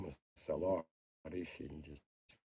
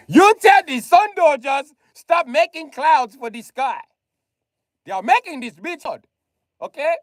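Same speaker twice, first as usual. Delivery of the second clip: mock scolding; 12 semitones higher, 5 dB louder than usual. Mock address to the Sun Dodgers.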